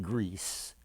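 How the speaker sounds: usual speaking voice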